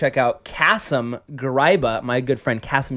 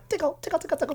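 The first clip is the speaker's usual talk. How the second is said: playful tickle; 9 dB quieter, much faster than usual. Acted.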